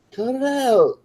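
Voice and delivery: high playful mock scold. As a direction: pretend to tell off the dog. Scolding a yawning dog, joking.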